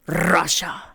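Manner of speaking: "Russia" with a hard, long rolled R; "Russia" opened on a rattling trill. Acted. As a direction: trill the R of "Russia" like a machine gun. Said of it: "every time you said Russia".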